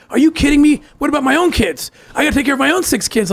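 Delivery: high-pitched, incredulous push-back; cowardly protest. Retold or imitated. Husband fighting back at his wife over their six kids, cowardly protest. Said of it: "fought back like a coward".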